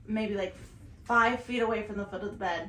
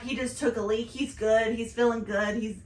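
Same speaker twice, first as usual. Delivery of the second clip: ordinary delivery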